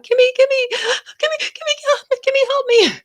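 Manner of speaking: high, loud imitated mother crying for help; mock hysterical sobbing mom. Retelling the fake call from her mom, high mock sobbing plea. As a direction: wail it like a panicked mother. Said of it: "start again in the back".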